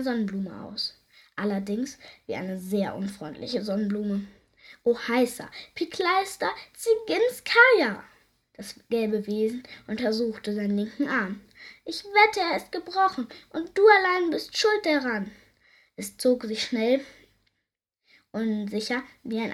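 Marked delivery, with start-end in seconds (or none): 4.8-8.0 high, rising creature exclamation; squeaky creature. A narrator's reading. Creature exclaims nonsense words. 11.8-15.3 accusing creature. A narrator's reading. Creature blames Biene.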